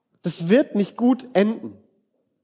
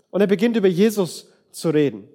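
normal talking voice

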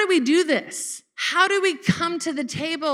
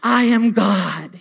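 louder, lower, slow mock scolding; God scolding his children, mock stern and loud; 6 semitones lower, 4 dB louder, slower than usual. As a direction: ham up a harsh judging God. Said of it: "He's not like".

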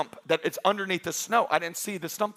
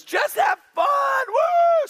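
much higher, loud mocking whoop; mock party whoop; 5 dB louder, slower than usual. Pastor mocking sixties free-love crowd, a shouted whoop. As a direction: hoot it like a sixties partier. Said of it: "they had this idea".

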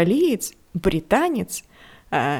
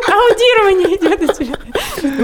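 higher, louder, slow, laughing through it; laughing mock panic; 13 semitones higher, 8 dB louder than usual. Lost on a bad English call, laughing in mock panic. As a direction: laugh at your own helplessness on the call. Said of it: "и ты такой".

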